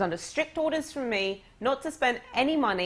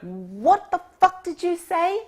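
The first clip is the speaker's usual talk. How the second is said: much higher, louder and slow, stunned anger; shocked outrage; 9 semitones higher, 6 dB louder, slower than usual. Retold or imitated. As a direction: hurl it back, offended and baffled. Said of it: "And he went".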